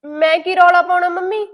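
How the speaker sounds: indignant loud denial; defensive outburst. Acted. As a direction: snap back in defense. Daughter defends herself to mother.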